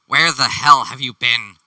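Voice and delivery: crisp, articulate snob voice; Cassius. Acted. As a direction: scold like a haughty noble. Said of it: "His voice is higher pitched and a little more articulate".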